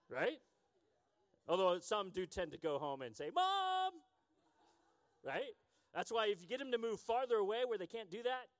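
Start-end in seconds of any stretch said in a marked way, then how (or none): 3.3-3.9 calling mom. Retold or imitated. Kid calling home for help.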